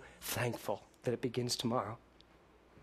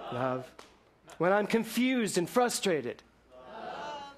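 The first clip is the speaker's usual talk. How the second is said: preaching litany; 5 semitones higher than usual. Acted. Litany of love in sermon.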